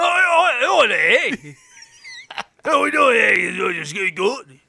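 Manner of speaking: put-on high, loud, absurdly botched Canadian accent; fake Canadian accent. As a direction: shout out a hopeless Canadian accent. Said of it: "do your Canadian accent".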